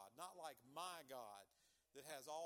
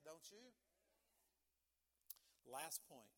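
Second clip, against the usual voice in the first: ordinary talk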